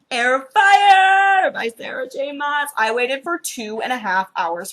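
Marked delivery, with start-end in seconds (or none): 0.1-2.7 loud, high, stretched title reveal; hyped, drawn-out announcement; triumphant announcement. Real, not acted. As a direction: proclaim the prized title slowly and loudly. Triumphant reveal of the prized book.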